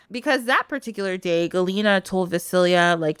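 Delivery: usual speaking voice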